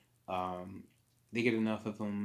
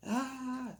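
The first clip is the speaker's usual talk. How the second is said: startled yelp. Retold or imitated. Both wake up snuggled.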